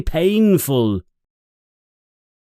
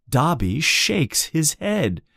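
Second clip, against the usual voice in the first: usual speaking voice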